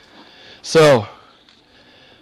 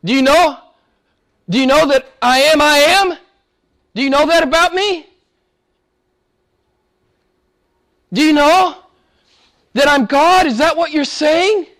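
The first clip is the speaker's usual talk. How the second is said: high, insistent, probing questions; Jesus questioning; 9 semitones higher, 4 dB louder than usual. Acted. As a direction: press the listener with each rising question. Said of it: "He's saying".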